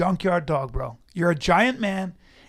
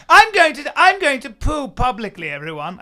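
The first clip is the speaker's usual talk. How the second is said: pompous announcement; 6 semitones higher, 6 dB louder than usual. Pretend. Grand announcement as a snob.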